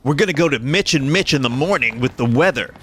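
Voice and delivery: cheesy radio host building up the hype. Pretend. Parody morning radio show banter.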